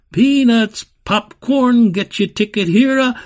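high, loud sales cry; carnival barker shouting. A narrator's reading. Barkers breaking into the girl's thoughts, loud high shouts. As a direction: holler your wares across the fair. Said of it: "Carnival barkers shouted".

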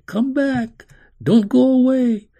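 high, loud call; crying out. A narrator's reading. Nurse to the faraway child, high desperate cry. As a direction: call out high and urgent to someone going away. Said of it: "and her own cry".

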